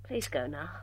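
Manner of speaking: soft, low plea; quiet pleading. Acted. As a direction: beg him softly to leave. Begging her father to leave.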